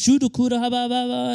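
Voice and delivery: light-hearted tongues babble; mock speaking in tongues. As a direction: playfully imitate praying in tongues. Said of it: "Some of us in tongues".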